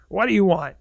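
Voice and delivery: grumpy bear disturbed by a visitor; gruff bear. Retold or imitated. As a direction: bark it like a bothered bear. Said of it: "super gruff".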